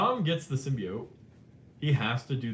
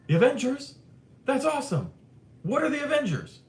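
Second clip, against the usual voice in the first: much higher, louder, puzzled exclaim; baffled Toby; 14 semitones higher, 5 dB louder than usual. Retold or imitated. Toby reacting to Tom's Avengers brag, high bafflement. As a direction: act out Toby totally clueless about the Avengers. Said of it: "Toby goes".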